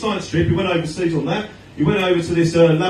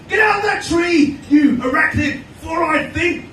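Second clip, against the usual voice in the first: put-on kid yelling; 11 semitones higher, slower than usual. Boy taunting another kid, yelled high. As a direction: shout the insult at the kid in the tree. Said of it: "he's going".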